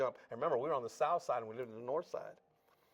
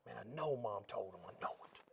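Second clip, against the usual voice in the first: hushed inner thought; 3 semitones lower, 10 dB quieter than usual. Retold or imitated. Nervous thought in church.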